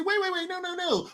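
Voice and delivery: high, hurried "Wait, wait, wait, no"; mock flustered official. Mocking California officials, high flustered protest. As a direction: backpedal like a panicked politician. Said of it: "they say".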